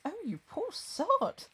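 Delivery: high, slow English governess voice; well-to-do English governess. Acted. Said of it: "well-to-do English governess rather than a pirate".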